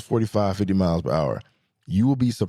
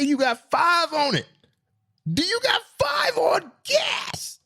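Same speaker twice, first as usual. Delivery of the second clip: very high, drawn-out mock holler; hyped-up younger self; slower than usual. Driver asking friends for gas money, high stretched holler. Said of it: "I used to say".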